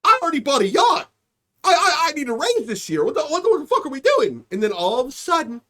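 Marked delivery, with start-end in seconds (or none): 0.0-4.5 greedy executive panicking. Retold or imitated. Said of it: "sitting in the boardroom like".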